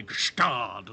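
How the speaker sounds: "Gestard" with a drawn-out opening hush; imitated pronunciation. Pundit teasing a colleague's way with a horse's name, imitated pronunciation. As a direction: relish the first sound of "Gestard", copying a colleague. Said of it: "I love the way you say".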